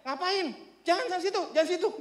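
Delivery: high-pitched, quick warning-off; imitated scolding. Neighbour guarding the house warns strangers off, mock scolding. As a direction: shoo someone away like a self-appointed guard. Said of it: "dia yang marahin".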